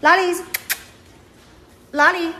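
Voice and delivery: high, singsong pet-calling voice; calling a dog. Real, not acted. Owner calling her dog to come, high coaxing voice.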